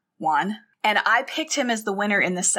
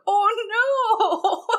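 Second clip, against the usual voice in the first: laughing oh no; 13 semitones higher than usual. Real, not acted. Laughing at Jürgen's unanswered phone.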